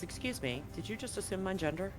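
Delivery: ordinary delivery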